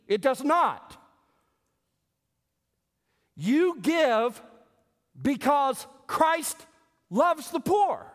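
loud, high, drawn-out preaching; preaching at full force. Acted. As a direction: drive the point home from the pulpit. Preaching climax on giving.

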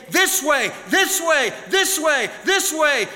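shouted high calls, each one pushed; calling out. Acted. God calls the lost in the storm, shouting. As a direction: cry out the way home.

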